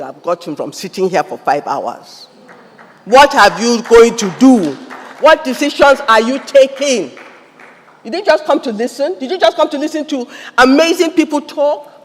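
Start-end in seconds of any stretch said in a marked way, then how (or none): 3.0-4.7 raised, ringing questions to the crowd; loud challenge. Real, not acted. Preacher challenging the audience, loud and pressing. 5.2-7.1 loud question. Real, not acted. Asked loud, later asked quietly. 8.0-11.9 raised, ringing questions to the crowd; loud challenge. Real, not acted. Preacher challenging the audience, loud and pressing.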